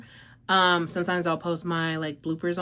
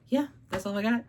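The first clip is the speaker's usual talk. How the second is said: slightly winded voice; out of breath; 3 semitones higher, 5 dB quieter than usual. Real, not acted. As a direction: talk a bit short of breath. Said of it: "I'm a little out of breath".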